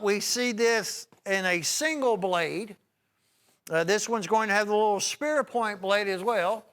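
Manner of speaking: high, loud shopping-channel pitch; pitchman sales pitch. Real, not acted. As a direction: sell it big and bright to the viewers. Sells knives on air.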